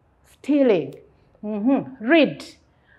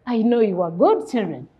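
normal talking voice

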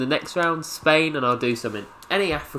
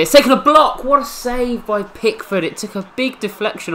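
higher, louder, thrilled call of the save; a real burst of excitement at the save; excited commentary; 11 semitones higher, 6 dB louder than usual. Real, not acted. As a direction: cheer the keeper like a commentator. Big save in the match.

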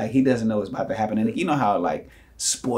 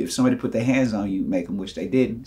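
usual speaking voice

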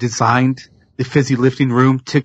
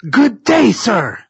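Wonka yelling; 10 semitones higher than usual. Retold or imitated. Candy maker yelling at the grandfather, high outraged shout. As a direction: throw him out with a furious good day. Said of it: "Wonka starts yelling at Grandpa Joe".

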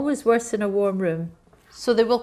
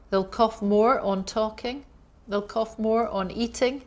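ordinary talk, nothing marked

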